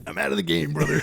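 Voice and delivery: high, gruff hammed-up wrestler shout. Joking about Abraham like a wrestler, hammed-up high shout. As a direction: ham it up like a pro wrestler.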